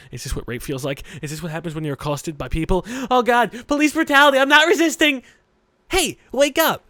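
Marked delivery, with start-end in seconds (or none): 3.1-5.2 hammed-up panicked shout, much higher and louder; joking terrified yell at the game; mock panic. Mock panic at being shaken. 5.9-6.8 put-on high, loud girl's call; Emi waking him.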